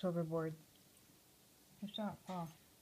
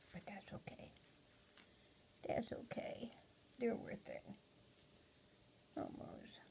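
whispered reassurance; 12 dB quieter than usual. Real, not acted. Owner whispering to her molting parrot, soft whisper. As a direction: breathe it softly to the bird. Murmuring to pet bird.

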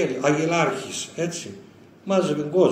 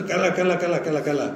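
laughing repetition; 2 semitones higher, 4 dB louder than usual. Retold or imitated. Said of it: "έλεγε".

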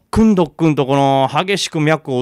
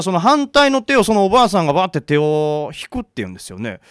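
usual speaking voice

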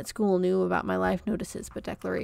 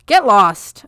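much louder shout; angry shout; 15 dB louder than usual. A narrator's reading. Comfort shouting at her cousin, angry shout. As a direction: shout him off before slamming the door. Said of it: "I shouted".

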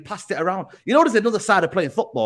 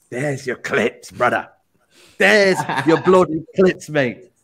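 slow, lower gloating taunt; 6 semitones lower, slower than usual. Real, not acted. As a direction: rub it in, slowly and low. Taunting rival with clips.

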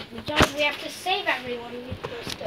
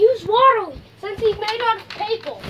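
shouting; 6 semitones higher, 8 dB louder than usual. Pretend. Shouting game advice.

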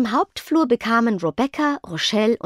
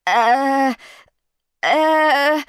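high, louder, stuck hesitation sound; stammering; 6 semitones higher, 5 dB louder than usual. A narrator's reading. Hudud is caught hiding by Rebecca, stammering at a raised pitch. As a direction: stall on drawn-out, high ers. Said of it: "stotterte Hudud".

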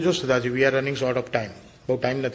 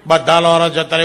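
laughing loud repeat; 3 semitones higher, 11 dB louder than usual. Real, not acted. Teller cracks up repeating the wish, laughing loudly.

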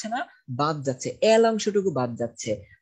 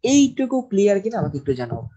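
ordinary delivery

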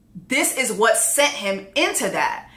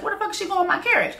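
fast, high, rushed mock panic; mock frantic outrage; 7 semitones higher, much faster than usual. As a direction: rattle it off like a frantic king. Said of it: "He's like".

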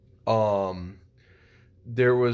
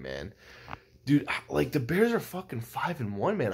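ordinary delivery

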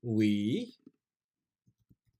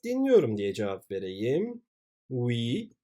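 normal talking voice

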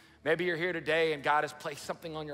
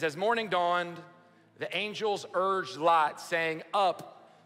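raised, measured Bible reading; scripture reading; 3 semitones higher, slower than usual. Acted. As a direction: read the verse out to the church. Reads Genesis aloud to congregation.

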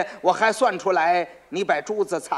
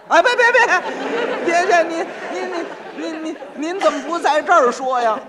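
much higher, loud stammering panic; panicked pleading; 11 semitones higher, 6 dB louder than usual. Acted. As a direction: beg him frantically not to tell. 崔英慌张求饶. (崔英惊慌失措地结巴求饶)

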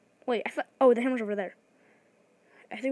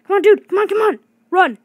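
much higher, loud desperate urging; urgent cheering; 8 semitones higher, 13 dB louder than usual. Real, not acted. Gamer screaming at a teammate to escape, frantic. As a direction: yell them on to escape.

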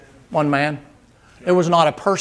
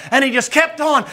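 ordinary delivery

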